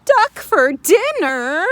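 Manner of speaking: slow high loud alarmed question; alarmed duck. Acted. Duck hearing it is dinner, high slow alarm. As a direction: duck horrified at the idea.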